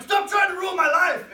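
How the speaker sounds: yelled high and loud in jest; husband shouting back at his vegan wife, hammed-up; mock angry shout. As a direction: blow up like a defensive husband. Arguing with wife about food.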